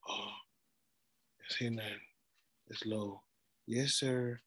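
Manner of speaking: low, very soft, falsely humble voice; mock humble. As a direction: put on meek, hushed deference. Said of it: "we talk like we be humble".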